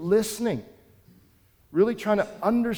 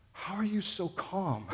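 amazed question; 3 semitones higher, 9 dB quieter than usual. Retold or imitated. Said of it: "he was so taken aback".

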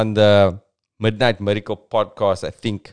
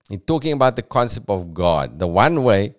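ordinary talk, nothing marked

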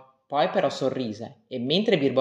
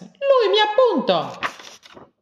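very high, slow, wailing complaint; whiny complaining cry; 5 dB louder, much slower than usual. Acted. Little Rex complains to the teacher, high drawn-out wail. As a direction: tattle loudly like a hurt child. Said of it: "gridò piccolo Rex".

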